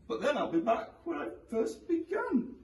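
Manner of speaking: put-on high, doubtful old woman; old lady voice. A narrator's reading. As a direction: object like a puzzled granny.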